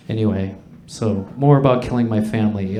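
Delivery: ordinary talk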